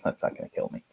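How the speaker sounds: breathy unvoiced throwaway; unvoiced whisper. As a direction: whisper it off like no big deal. Retold shrug about doorbell wiring.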